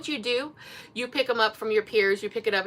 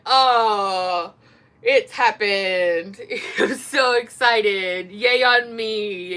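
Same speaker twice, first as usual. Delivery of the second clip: loud, very slow, drawn-out gushing; hammed-up dreamy triumph, stretched out; mock celebration; 4 dB louder, much slower than usual. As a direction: savor each word in pretend self-congratulation. Mocking self-congratulation she never does.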